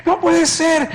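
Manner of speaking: high, anguished cry of disbelief; grito de incredulidad. Retold or imitated. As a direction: cry out in desperate denial. Relives his panic at seeming dead. (grito agudo de incredulidad)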